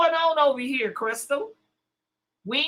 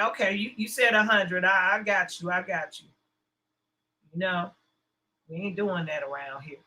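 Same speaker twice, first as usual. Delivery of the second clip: ordinary talk, nothing marked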